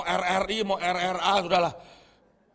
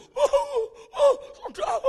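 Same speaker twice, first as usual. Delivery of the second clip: mock tearful laugh; 7 dB louder, slower than usual. Mimics crying laughter.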